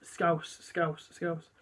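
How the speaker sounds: quiet, tentative go at Scouse; trying Scouse under his breath; attempted Scouse accent. Impressionist about to give up, quiet attempted Scouse accent. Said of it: "a deep Scouse accent".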